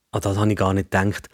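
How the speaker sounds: plain reading voice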